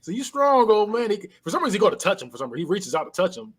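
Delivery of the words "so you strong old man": mocking jeer, higher voice; taunting. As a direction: sneer at the old man. Taunting the old man.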